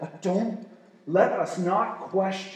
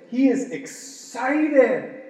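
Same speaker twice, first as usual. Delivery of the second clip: excited; 8 semitones higher, 5 dB louder, much slower than usual. Real, not acted. Emphatic excited delivery.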